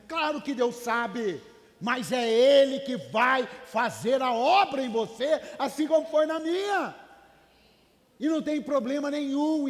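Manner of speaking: loud, slow, high preaching shout; preaching at full force. Real, not acted. Preacher shouting to the church that God will do the work, loud and slow. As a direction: shout it to the congregation, slow and heavy.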